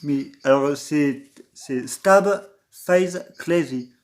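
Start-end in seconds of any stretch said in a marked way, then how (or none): 1.9-3.9 slow, clumsy English accent on Stab Face Crazy; lightly English-accented name, said slowly; bad English accent. Shown as a demonstration. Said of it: "excuse-moi pour mon accent anglais nul".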